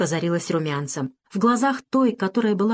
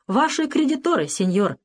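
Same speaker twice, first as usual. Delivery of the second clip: firm; 8 semitones higher than usual. A narrator's reading. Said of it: "твёрдо объявил Альваро".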